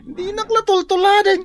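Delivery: excited brag. Acted. Bragging about new things.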